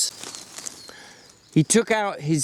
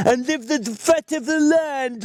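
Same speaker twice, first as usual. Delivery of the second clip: shouting; 13 semitones higher than usual. A narrator's reading. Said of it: "Lenny shouted".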